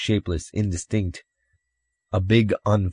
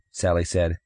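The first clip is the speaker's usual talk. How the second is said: usual speaking voice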